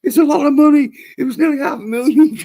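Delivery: high, loud frantic pleading; panicked old man. Retold or imitated. Fake old man begging the scammer Joe, high loud panic. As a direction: wail at Joe in mock panic over the lost money. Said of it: "he's all like".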